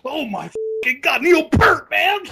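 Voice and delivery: high, loud excited yell; excited shout. Real, not acted. Fan hearing a favourite drummer named, excited high shout. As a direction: yell the name like a thrilled fan.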